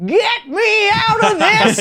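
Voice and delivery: put-on high, slow, strained old man's plea; old person voice. Grandpa pleading during the blackout night, high slow old voice. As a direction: beg in a high old-man voice. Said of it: "you do an incredible old person".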